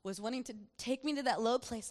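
normal talking voice

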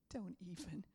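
low, hushed lion's warning; quiet menacing don't even. Retold or imitated. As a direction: stare him down in a near-hush. Lion staring down the croc.

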